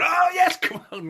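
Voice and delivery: sudden high, loud cheer from the stands; imitating a crowd section's burst of cheering. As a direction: yell it like an overexcited fan. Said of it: "one section of the crowd going".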